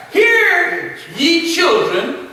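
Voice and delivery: drawn-out, reverent reading of the verse; slow scripture reading. Acted. Preacher reading scripture to the class. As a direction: read it slowly like holy writ.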